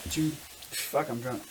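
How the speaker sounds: ordinary talk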